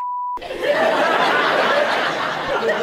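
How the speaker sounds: silent wheezing laugh; breathless laughter. Real, not acted. Comedian quoting dad breaks into silent wheezing laugh. Comic laughing at own story.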